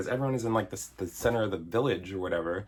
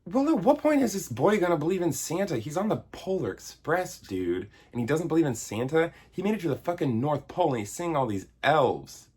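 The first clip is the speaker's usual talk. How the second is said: exasperated rant; 6 semitones higher than usual. Retold or imitated. Vlogger ranting at the hero boy, exasperated. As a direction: vent at the silly boy. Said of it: "And I'm like".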